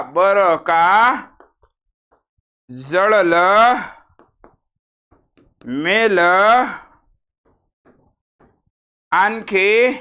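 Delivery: each filler word drawn out with long pauses; slow deliberate dictation; slow, spaced-out listing. Shown as a demonstration. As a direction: dictate them slowly one by one. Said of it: "हे शब्द आहेत".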